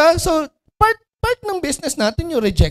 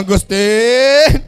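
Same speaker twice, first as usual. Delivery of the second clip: whiny crying sales pitch; 2 semitones lower, much slower than usual. Retold or imitated. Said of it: "Hindi ganon".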